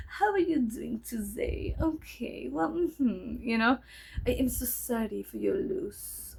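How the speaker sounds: put-on slow French-sounding accent; imitated South African accent. Psychiatrist condoling with patient on the phone, imitated French-sounding accent. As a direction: imitate a French-tinged psychiatrist, drawing the words out. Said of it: "That's how he sounds".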